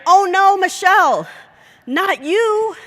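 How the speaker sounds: high, loud gasp of dismay; shocked cry. Retold or imitated. Coworker spotting Michelle in the layoff room, shocked high cry. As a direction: cry out in horrified surprise.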